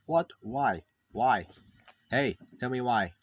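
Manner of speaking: loud, insistent questioning; demanding outburst. Acted. Challenging the character to explain, demanding outburst. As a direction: demand an answer forcefully.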